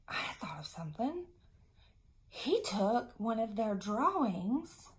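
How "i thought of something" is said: excited hushed whisper. Acted. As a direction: whisper the idea like a secret. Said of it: "whispered mock discovery".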